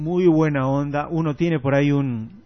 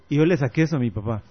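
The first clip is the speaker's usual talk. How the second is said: normal talking voice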